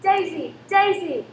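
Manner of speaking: frantic loud calls, rising; calling out. Acted. Mother searching the museum for her daughter, frantic calling. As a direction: shout for the lost child.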